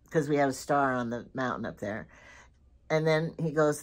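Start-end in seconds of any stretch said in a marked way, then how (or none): none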